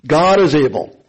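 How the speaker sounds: preacher's emphasis on "is"; emphasized words. Shown as a demonstration. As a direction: lean into "is" as proof. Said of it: "I'm gonna emphasize some words here".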